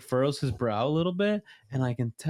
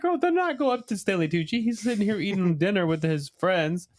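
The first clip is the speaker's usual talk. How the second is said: scolding; 7 semitones higher than usual. Retold or imitated. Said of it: "Because I'm like".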